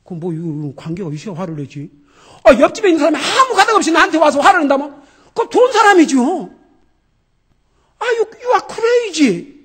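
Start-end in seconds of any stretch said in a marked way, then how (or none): 2.4-9.6 mock outraged neighbor. Mock angry neighbor scenario.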